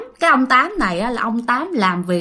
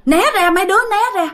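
higher, urgent retold warning call; 8 semitones higher, faster than usual. Mother warning the children away from father, retold urgent call. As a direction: call out a warning, pitched up, not a full shout. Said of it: "cho nên đó là mẹ kêu".

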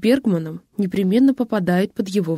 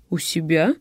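softer, lower puzzled repeat; questioning echo; 4 semitones lower, 4 dB quieter than usual. Acted. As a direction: ask it back in mild disbelief. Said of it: "переспросил Бергман". (переспросить с лёгким недоумением, тише)

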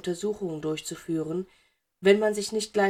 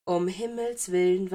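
ordinary delivery

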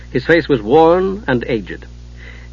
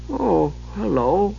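soft, slow, dazed kender; vague; 6 semitones higher, 6 dB quieter, slower than usual. Acted. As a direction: greet him as if half asleep. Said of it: "Tass smiled vaguely".

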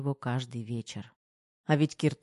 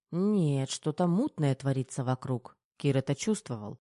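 plain reading voice

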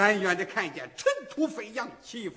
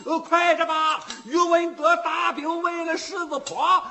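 loud, high-pitched shout; full-voiced, high warning cry; urgent hollering; 10 semitones higher, 9 dB louder than usual. A narrator's reading. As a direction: burst in yelling the alarm to the household. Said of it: "进门儿他就嚷上了".

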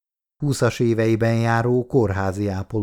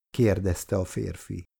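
plain reading voice

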